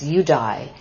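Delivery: ordinary talk, nothing marked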